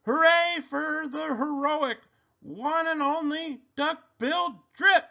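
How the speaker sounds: very high, happy hooted cheer; hooting. A narrator's reading. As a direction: hoot a triumphant hurray, way up high. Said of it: "They hooted".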